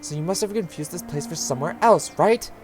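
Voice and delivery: strained maternal persuasion; Rosa. Acted. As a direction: reason with a stubborn child, rising at the end. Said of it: "Oh, wait, it's this Rosa".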